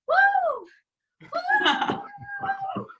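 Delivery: high cheering woo; excited cheer. Real, not acted. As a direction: let out a big happy woo. Cheering at end of speech.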